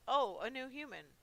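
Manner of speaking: lightly voiced old man with a cough; old man monster. Monster waking to find a human visitor, lightly voiced old man. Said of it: "His voice and cough sounded more like an old man".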